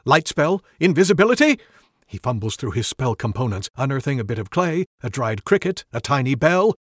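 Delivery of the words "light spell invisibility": panicked questions. Acted. Wizard panics.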